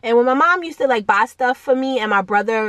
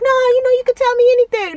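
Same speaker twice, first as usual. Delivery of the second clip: much higher, louder hammed-up dad voice; coaxing dad; 5 dB louder than usual. Dad urging his kid to open up, hammed-up and high. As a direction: play the eager reassuring dad.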